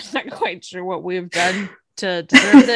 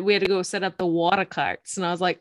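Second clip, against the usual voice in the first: usual speaking voice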